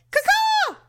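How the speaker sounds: very high, loud caw-caw crow call; family crow call. Shown as a demonstration. Mother doing her family's crow call. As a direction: belt the bird call out. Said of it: "That's our family call".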